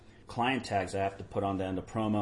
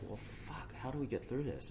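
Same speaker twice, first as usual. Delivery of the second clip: "Well, fuck" dropped much softer, under the breath; muttered frustration; 3 semitones higher, 11 dB quieter than usual. Retold or imitated. Stuck producer in a pricey studio, softly exasperated. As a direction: sigh it out quietly to yourself. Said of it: "you're like".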